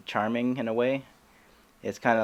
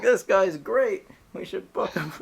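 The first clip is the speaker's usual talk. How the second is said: stoned audience member; 10 semitones higher, 5 dB louder than usual. Retold or imitated. Comic imitates a delighted high festival-goer, goofy gushing. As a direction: gush in a silly high voice. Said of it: "And this guy's like".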